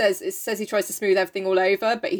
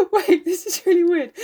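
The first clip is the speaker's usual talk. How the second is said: laughing; 7 semitones higher than usual. Real, not acted. Laughing while reading.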